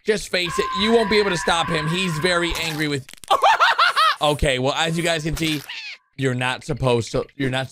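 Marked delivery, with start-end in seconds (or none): 3.3-4.1 high, sudden laugh; burst of laughter. Real, not acted. Amused by angry Red, a high burst of laughter. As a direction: crack up at the scene.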